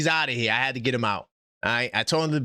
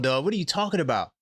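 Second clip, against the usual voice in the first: ordinary delivery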